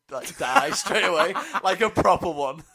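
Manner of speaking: loud laughing. Real, not acted. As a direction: crack up while saying it. Laughing about dying at competition.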